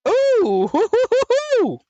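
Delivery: high, loud gleeful hoots; excited hooting. Real, not acted. Streamer hooting with glee at the desktop. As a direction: hoot with delight.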